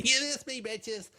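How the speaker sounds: high sing-song jeer that drops off to almost nothing; mocking taunt. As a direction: tease like a smug kid who got away. Mock taunt quoted from defendant.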